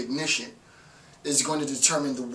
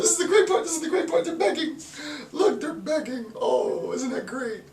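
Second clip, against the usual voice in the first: much higher, mocking gleeful voice; gloating institution; 12 semitones higher than usual. Lecturer voicing the institution watching people beg, high and gloating. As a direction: cackle over the beggars like a smug official. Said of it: "they're just like".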